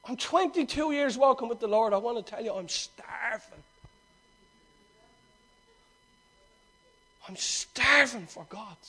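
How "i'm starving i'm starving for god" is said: hushed intensity. Real, not acted. Preacher of twenty-two years confesses hunger for God, hushed intensity. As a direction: stretch STARVING slowly and quietly.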